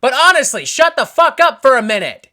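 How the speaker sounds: loud, high exasperated yell; mad-sounding shout. Acted. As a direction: shout them down after the apology. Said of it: "sorry if it sounds like I'm mad at you".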